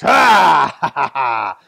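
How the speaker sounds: loud booming villain laugh; mocking laugh. A narrator's reading. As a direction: laugh big at the frightened man. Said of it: "And Khan laughed".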